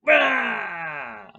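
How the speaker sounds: long falling "aah" yell; drawn-out taunting cry, sliding down in pitch; re-enacted wordless jeer; defiant yell. Acted outburst with double bird.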